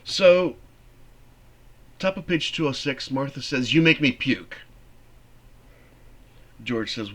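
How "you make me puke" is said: loud, sneering insult; disgusted jab. Acted. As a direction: spit it at him with contempt. Teacher acting Martha's insult.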